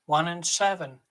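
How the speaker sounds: loud, drawn-out bingo calls; bingo caller. Acted. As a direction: call the numbers across the hall. Bingo caller calling numbers.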